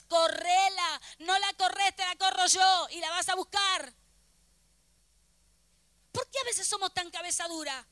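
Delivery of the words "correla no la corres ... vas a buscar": loud, insistent rebuke; exasperated scolding. Acted. God scolding believer who goes back for that person, loud exasperation. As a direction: scold with forceful, punched words.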